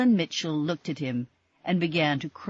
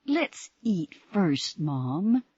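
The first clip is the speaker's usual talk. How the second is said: ordinary delivery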